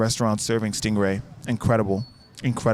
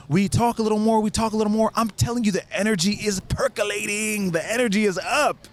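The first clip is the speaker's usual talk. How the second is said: high-pitched hype, words stretched for emphasis; mounting excitement; 6 semitones higher than usual. Real, not acted. Telling how the energy percolated, mounting excitement. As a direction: build the hype in a raised pitch.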